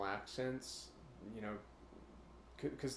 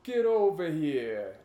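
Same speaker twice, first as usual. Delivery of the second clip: much louder, slow, higher East Coast gangster; Italian-tinged East Coast accent; 7 semitones higher, 13 dB louder, slower than usual. Retold or imitated. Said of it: "it kinda does sound Italian".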